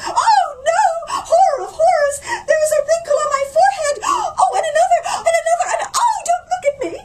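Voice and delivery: shrieked very high and loud, fast; shrieking. Acted. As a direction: shriek in horror at the mirror. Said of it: "Suddenly she shrieked".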